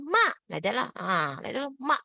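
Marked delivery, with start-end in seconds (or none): 0.0-0.3 MA short, dropping sharply; falling fourth tone. Shown as a demonstration. Teacher modelling the fourth tone, like scolding someone. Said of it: "when you scold someone". 1.8-2.0 MA short, dropping sharply; falling fourth tone. Shown as a demonstration. Teacher modelling the fourth tone, like scolding someone. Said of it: "Scold someone".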